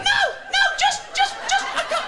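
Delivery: high, panicked stammer; flustered squeak. Retold or imitated. Shopper at the till who forgot the cornflakes, a high flustered stammer.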